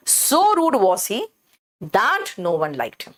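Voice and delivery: So rude was he very slow with rude leaned on, then that no one liked him slow; teacher modelling the inverted so-sentence; stress on so. Shown as a demonstration. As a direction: spell the emphasis out word by word from So. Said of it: "so पर जोर दे रहे हैं".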